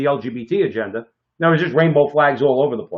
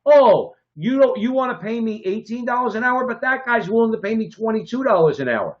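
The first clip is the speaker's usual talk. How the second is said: a worker weighing two offers, voiced higher; lightly voiced worker playing two bosses off each other, higher; mock worker comparing two wage offers, higher; imitating a worker; 7 semitones higher than usual. Voicing a worker weighing job offers.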